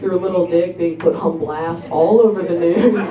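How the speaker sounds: ordinary talk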